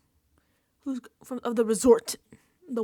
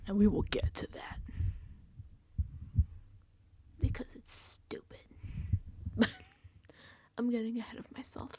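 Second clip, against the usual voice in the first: hushed whisper to the audience; soft conspiratorial whisper; whispered aside; 5 dB quieter than usual. Real, not acted. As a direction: lean in and whisper it.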